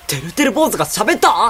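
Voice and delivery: loud, high disbelief; shocked shout. Acted. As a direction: yell at a talking doll. Shocked that the doll talks.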